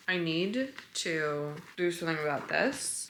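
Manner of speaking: ordinary delivery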